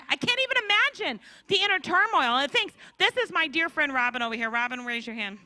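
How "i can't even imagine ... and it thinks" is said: ordinary delivery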